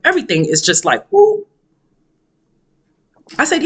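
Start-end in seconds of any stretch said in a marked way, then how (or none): 1.1-1.5 quick high-pitched whoop; imitated whoop. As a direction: mimic her reaction with a high little whoop. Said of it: "her tone and everything, body language".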